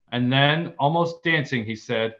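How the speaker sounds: ordinary talk, nothing marked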